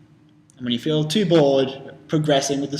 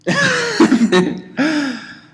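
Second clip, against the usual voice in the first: loud laugh, high and bright; big laugh; 13 semitones higher, 7 dB louder than usual. Real, not acted. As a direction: burst out laughing. Laugh after the Piglet impression.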